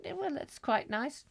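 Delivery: high prim wife voice. Acted. Wife answering husband about baby, high prim voice. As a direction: pitch it up as the fussing wife.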